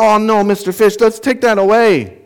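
high-pitched imitation of alarmed kids; kids protesting. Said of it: "And they're like".

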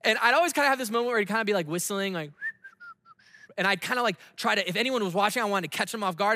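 normal talking voice

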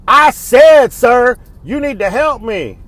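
loud, high, indignant demand; mock shout, stretched out for emphasis; imitated irate shopper. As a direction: mimic a customer insisting on service.

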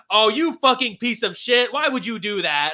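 angry wife. Retold or imitated. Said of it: "his wife is like".

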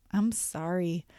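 low, soft, earnest apology; meaning it. Shown as a demonstration. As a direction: apologise quietly from the heart. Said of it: "saying it like you mean it could sound more like".

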